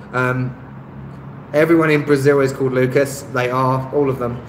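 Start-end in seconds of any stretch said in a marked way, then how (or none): none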